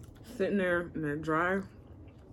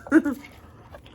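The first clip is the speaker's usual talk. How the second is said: laugh; 9 semitones higher, 9 dB louder than usual. Real, not acted. Laughs at eating all flats.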